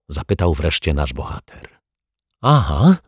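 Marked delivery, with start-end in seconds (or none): 2.4-3.0 louder, higher, cocky agreement. A narrator's reading. Neighbour cockily confirming to the caretaker. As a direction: snap it back, sure of herself. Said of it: "pokiwała buńczucznie głową".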